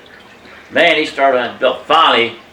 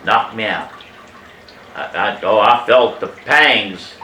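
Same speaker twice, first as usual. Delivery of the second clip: ordinary talk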